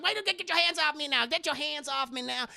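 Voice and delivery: high, fast mock outrage; imitating Madea being carried off, protesting; indignant protest. Madea lifted by party goers.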